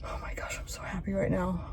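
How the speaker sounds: breathless whisper of relief; relieved whisper. Real, not acted. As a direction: whisper it, so happy it's out. Relief after part comes out.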